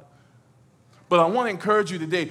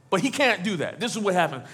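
ordinary delivery

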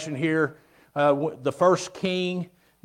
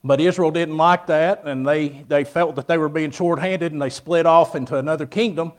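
preaching; 6 dB louder than usual. Acted. Preaching to the congregation.

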